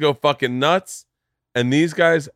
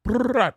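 gunfire noise; 12 semitones higher than usual. Acted. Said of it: "Why did I have to give them that accent?".

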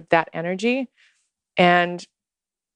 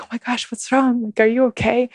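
imitating a worried husband, pitched higher; 5 semitones higher than usual. Husband Eric sees her come back in tears, mock alarm. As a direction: play him alarmed and pitched up. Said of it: "and Eric's like".

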